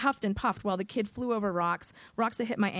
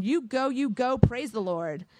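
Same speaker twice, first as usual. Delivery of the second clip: cheering; 5 semitones higher, 3 dB louder, slower than usual. Acted. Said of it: "cheered Praise the Lord Man".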